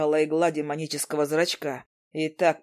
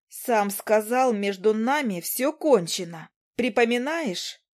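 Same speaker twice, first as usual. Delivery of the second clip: put-on higher, slower young woman's reproach; Izi's voice; 6 semitones higher, slower than usual. Izi throwing his words back at Demian, higher and slower. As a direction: remind him coldly, in a girl's voice.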